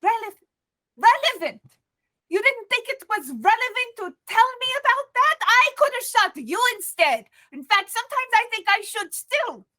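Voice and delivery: high, loud, furious outburst; furious shout. Acted. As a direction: yell it, half losing control. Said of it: "she pulls her head up and she's mad".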